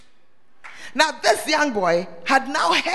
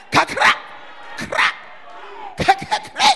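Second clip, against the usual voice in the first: mimicked thunder crack; 7 semitones higher, slower than usual. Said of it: "the lightning will come".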